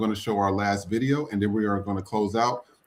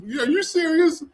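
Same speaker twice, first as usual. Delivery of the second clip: incredulous; 4 dB louder, slower than usual. Real, not acted. Incredulous high retort.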